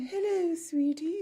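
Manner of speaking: high-pitched, hammed-up feminine greeting; Mrs. Doubtfire. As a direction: coo it in a put-on falsetto. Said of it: "just a more feminine version of it".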